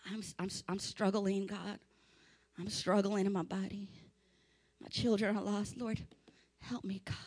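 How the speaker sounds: very soft, low, defeated murmur; hushed, halting complaint to God; mock feeble prayer. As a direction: pray limply, as if giving up. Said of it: "You can't just, just say".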